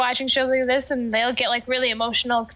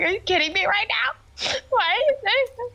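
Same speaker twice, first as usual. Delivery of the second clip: high, fast, tearful mock outrage; mad and upset; 9 semitones higher, faster than usual. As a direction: replay the hurt anger, voice cracking up high. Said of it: "Be like".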